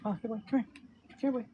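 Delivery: high coaxing dog call. Real, not acted. Calling his dog Paul over for viewers, high coaxing call. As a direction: call the pup over, sing-song.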